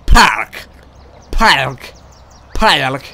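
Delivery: exaggerated accent on "Park", drawn into "Paahlk"; mimicked announcer's accent. Player mocking how the park announcer says park, exaggerated accent. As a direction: punch out "Park" loudly in a mock announcer's accent. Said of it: "how he says park".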